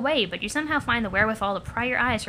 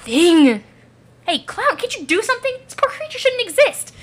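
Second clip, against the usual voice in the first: high, urgent appeal; distressed plea; 9 semitones higher, 7 dB louder than usual. Acted. Onlooker begging the clown to help the creature, distressed. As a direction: plead in alarm, pitched high.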